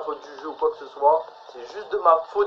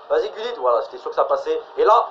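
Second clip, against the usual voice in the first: ordinary delivery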